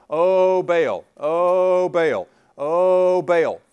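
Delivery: loud, drawn-out mock invocation; calling on Baal. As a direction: chant it out long and loud to an idol. Acting out prophets calling on Baal.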